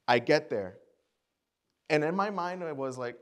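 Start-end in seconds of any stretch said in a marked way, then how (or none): none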